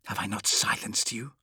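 quiet voiceless whisper; tense whisper. Acted. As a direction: hiss back at a voice inside. Fulgrim answers the inner demon.

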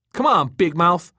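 loud, high angry challenge; snapping. A narrator's reading. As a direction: snap at a loudmouth friend. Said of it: "Tom snapped".

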